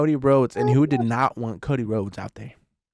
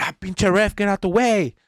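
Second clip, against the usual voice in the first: annoyed snap; 7 semitones higher, 6 dB louder than usual. Retold or imitated. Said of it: "he's almost like".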